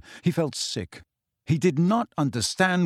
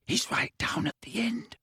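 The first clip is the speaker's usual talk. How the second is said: whispering; 9 semitones higher, 7 dB quieter than usual. A narrator's reading. Creeping through dark aisles.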